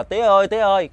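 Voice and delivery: calling out high and loud for father. Retold or imitated. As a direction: shout it across the field, searching. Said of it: "kêu um sùm". (gọi lớn, cao giọng)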